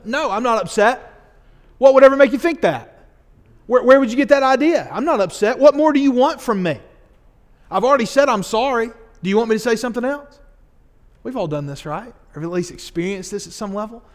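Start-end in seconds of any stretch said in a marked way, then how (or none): 0.0-10.4 louder, higher, rushed protest that he's not upset; defensive denial. Pretend. A sulking friend to Matt, loudly denying being upset. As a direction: snap back, insisting you're fine.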